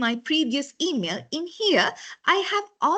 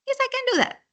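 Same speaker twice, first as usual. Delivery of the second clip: bright, much higher eager yes; confident reply; 12 semitones higher, much faster than usual. Retold or imitated. Telling her boss she can handle it, confidently. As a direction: answer like you're sure you can do it. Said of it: "So I confidently said".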